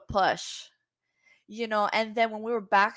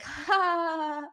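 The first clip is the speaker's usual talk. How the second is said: mock laugh; 8 semitones higher than usual. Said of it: "I started laughing like".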